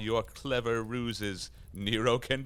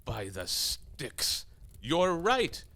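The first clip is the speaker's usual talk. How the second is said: startled outburst; 5 semitones higher, slower than usual. Acted. Devil suddenly agrees with Santa.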